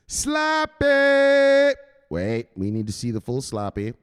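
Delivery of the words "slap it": much higher, louder cheer; hyped shout. Real, not acted. Hyping a viewer to chat, loud high shout. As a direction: yell it to the chat.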